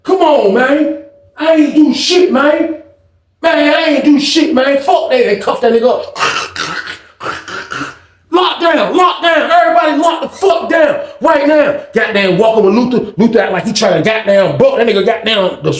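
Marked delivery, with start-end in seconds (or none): 0.0-5.2 much higher, whiny protest; protesting innocence. Retold or imitated. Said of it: "Luther was like". 8.3-11.8 lockdown order shouted. Retold or imitated.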